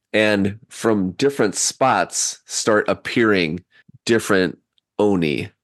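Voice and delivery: ordinary talk, nothing marked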